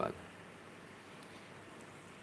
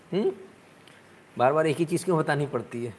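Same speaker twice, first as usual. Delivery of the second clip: ordinary talk, nothing marked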